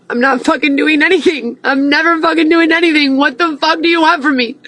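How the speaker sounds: fed up with being accused again; high-pitched, furious protest; exasperated outburst. Real, not acted.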